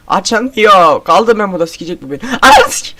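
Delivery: loud frantic panic, voice cracking high; real terror, shouting; panicked yelling. Real, not acted. Trapped by monster, door won't open.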